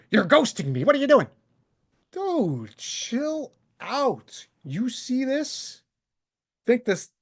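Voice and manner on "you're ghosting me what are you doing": mocking whine.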